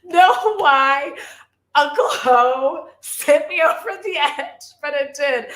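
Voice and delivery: fighting a real giggle fit; laughing. Real, not acted. Giggling fit over Uncle Ho.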